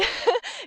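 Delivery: bright little laugh; short laugh. Real, not acted. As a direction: laugh briefly, amused. Laughs at friend's remark.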